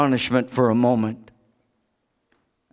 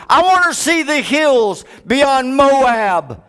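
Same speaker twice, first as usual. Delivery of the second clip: high, loud longing plea; impassioned preaching; 8 semitones higher, 3 dB louder than usual. Acted. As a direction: build it into a rising cry to the Lord.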